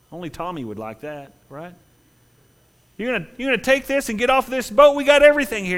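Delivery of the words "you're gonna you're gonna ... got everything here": high, loud taunting voice; mocking partygoer. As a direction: boast of the ship's plenty. Crowd mocking the leaver.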